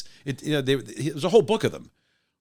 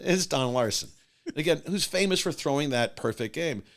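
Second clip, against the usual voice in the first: ordinary delivery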